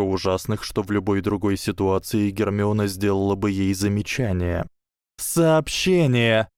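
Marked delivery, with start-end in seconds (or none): 5.2-6.5 horrified cry. A narrator's reading. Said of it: "с ужасом воскликнула Дафна".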